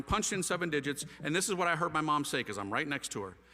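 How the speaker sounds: usual speaking voice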